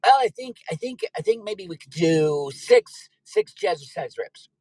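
hammed-up pleading exercise guru; Richard Simmons. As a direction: hesitate like a cornered aerobics instructor. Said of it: "The Count has it in for Richard Simmons".

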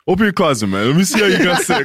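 high, laughing mock dad lecture; laughing dad impression. Son mimicking his dad's lecture, high and laughing. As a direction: play the know-it-all dad through laughter. Voicing dad inspecting room.

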